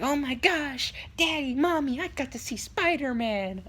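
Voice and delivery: put-on high, starstruck child voice; imagined excited kid. Kid telling parents about Spider-Man, imitated excitement. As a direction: gush to parents like a little kid. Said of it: "go home and be like".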